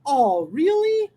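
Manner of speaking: slow, drawn-out surprised question; amazed, lingering rise on really. Shown as a demonstration. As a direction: stretch it out in astonishment, pitch swinging up. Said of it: "num sinal de espanto".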